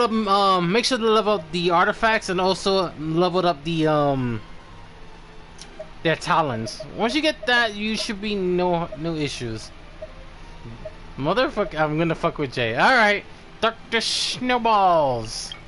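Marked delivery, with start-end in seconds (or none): none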